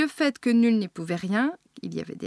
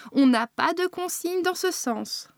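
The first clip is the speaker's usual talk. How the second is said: higher-pitched interruption; cutting in; 7 semitones higher than usual. A narrator's reading. Manager interrupting the caller, curtly cutting in. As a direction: break in firmly, pitched up. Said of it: "coupa la responsable".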